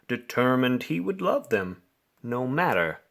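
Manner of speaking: usual speaking voice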